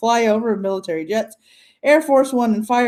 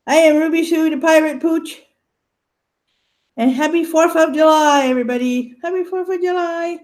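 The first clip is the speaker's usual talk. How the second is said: voicing the dog; 6 semitones higher, 3 dB louder than usual.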